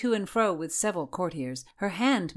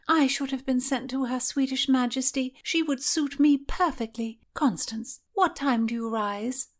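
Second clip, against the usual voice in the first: pitched-up chatty lady-in-waiting; Mary's voice; 8 semitones higher than usual. Acted. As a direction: beckon her in a lilting high voice. Mary continues.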